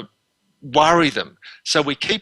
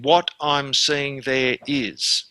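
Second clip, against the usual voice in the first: slow clinical demo; much slower than usual. Shown as a demonstration. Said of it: "And then".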